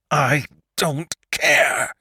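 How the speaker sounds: Lantry crying out while they wrestle; cried out. A narrator's reading. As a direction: wail the refusal slowly. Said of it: "cried Lantry".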